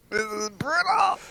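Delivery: high, drawn-out mock wail. Real, not acted. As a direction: wail the word brutal, slowly.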